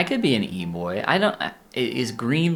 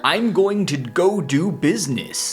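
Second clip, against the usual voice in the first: 1930s businessman voice; 5 semitones higher than usual. Pretend. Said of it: "nineteen thirties businessman".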